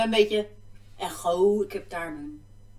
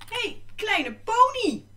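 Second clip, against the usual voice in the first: tender; 13 semitones higher, 5 dB louder, much slower than usual. Real, not acted. Greets her pony.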